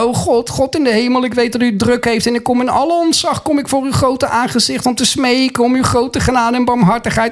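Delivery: hammed-up humble begging; mock grovelling prayer. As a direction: parody a servile supplicant praying. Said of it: "Je hoeft niet zo te bidden".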